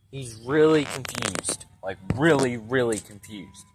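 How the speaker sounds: slow, stretched stress on really; drawn-out emphasis. Real, not acted. Telling viewers how baffled his dad was, slow emphatic stress. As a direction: lean hard on each really, dragging it out. Describing dad's confusion over gears.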